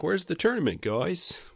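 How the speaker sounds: high, confused lost voice; lost Johnny Cage voice. Retold or imitated. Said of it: "be like".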